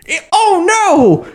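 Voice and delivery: high, slow "oh no"; mock alarm. Real, not acted. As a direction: act out sudden dismay. Realizing the cowboy name.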